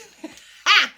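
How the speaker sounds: a loud burst of laughter. Real, not acted. Laughing at her joke about Daniel, a loud real laugh.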